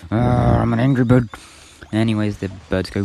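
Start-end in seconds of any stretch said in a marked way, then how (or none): 0.1-1.3 mock angry. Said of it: "driving me angry".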